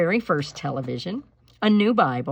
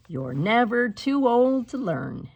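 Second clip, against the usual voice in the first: warm; 6 semitones higher, 4 dB louder, slower than usual. A narrator's reading. Old woman's closing wisdom.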